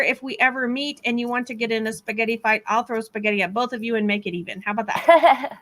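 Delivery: normal talking voice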